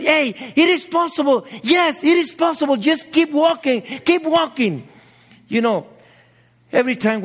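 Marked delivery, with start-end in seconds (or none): 0.0-4.9 encouraging cheer. Retold or imitated. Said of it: "we tell them".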